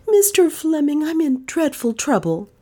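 put-on high young woman pleading; courageous appeal. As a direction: appeal to him bravely. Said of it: "with a courageous appeal".

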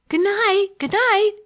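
high, loud mocking child's cry; a little girl jeering at the grown-ups; derisive child's cry. A narrator's reading. Little girl jeering at the party guests, high loud cry. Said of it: "cried Olivia ... in derision to the company".

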